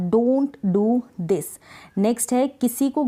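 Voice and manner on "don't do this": very slow, each word set apart; slow dictation. Shown as a demonstration. Teacher dictating an English sentence to learners, very slow. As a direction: spell it out slowly for students. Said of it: "करना यानी कि do और this".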